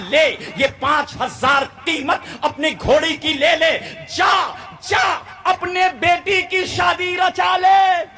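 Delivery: building to high, drawn-out shouts; preacher acting a grand, insistent giver; generous saint commanding. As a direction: send him off with a ringing cry. Saint hands money, sends him off.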